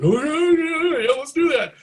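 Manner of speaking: high-pitched, mock-giddy yelp; eager fanboy. Young player accepting lessons from his idol, eager fanboy. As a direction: gush an over-eager yes, hammed up. Acted eager yes to idol's offer.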